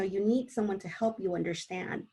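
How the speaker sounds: ordinary delivery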